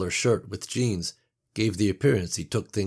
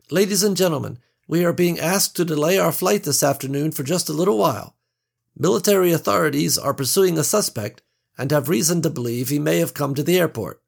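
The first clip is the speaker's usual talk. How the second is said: high, bright cabin announcement voice; polished stewardess PA announcement; flight attendant; 8 semitones higher, 5 dB louder than usual. A narrator's reading. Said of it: "stewardess addresses passengers".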